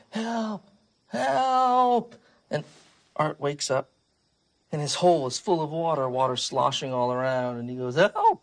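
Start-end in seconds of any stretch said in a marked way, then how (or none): 0.1-2.1 high cry building louder; acted yell for help. Goldie on the tossed boat, yelling high for help. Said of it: "And she's yelling". 7.9-8.4 sudden high, loud yelp; acted yell. Art wakes in his flooded hole, yelling high. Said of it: "He yells".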